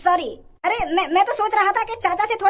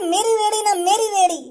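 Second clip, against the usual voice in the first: mock crying; 3 semitones higher than usual. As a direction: whine it like a fake cry. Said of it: "अब रो रहे आंसू में बहा रहे". (नकली रोते हुए, चिढ़ाते अंदाज़ में)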